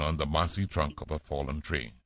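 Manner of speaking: ordinary delivery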